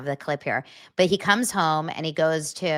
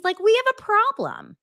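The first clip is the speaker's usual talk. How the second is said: much higher, mock alarmed; squeaky alarm; 3 dB louder than usual. Real, not acted. Vlogger sounding the alarm, mock panic up high. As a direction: pitch it up in panic.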